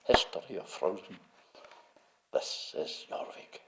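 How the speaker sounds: hushed, portentous narrator impression; Magnus Magnusson. Announcing Jorvik to time-car riders, imitated, hushed and portentous. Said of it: "Magnussen's voice said".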